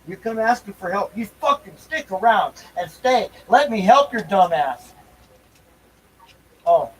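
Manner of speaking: ordinary talk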